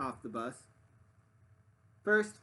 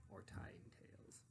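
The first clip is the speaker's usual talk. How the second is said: whisper; 22 dB quieter, slower than usual. A narrator's reading. Narrator whispers list end.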